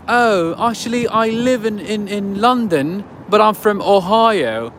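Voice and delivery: slow, high imitated British accent. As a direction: play the customer in a drawn-out mock British accent. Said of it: "with a British accent".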